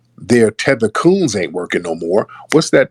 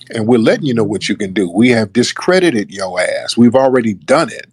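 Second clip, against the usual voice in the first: usual speaking voice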